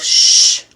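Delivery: a long unvoiced shh; shushing. Shown as a demonstration. Vlogger tells his racing mind to hush. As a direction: hush the thoughts firmly. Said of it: "I often tell myself".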